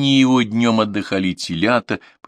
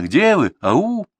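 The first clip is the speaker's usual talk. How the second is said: high loud hallooing; calling out; 10 semitones higher, 4 dB louder, much slower than usual. A narrator's reading. Granddaughter searching the farmstead, calling out loud. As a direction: call out searching the yard. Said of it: "позвала Катерина".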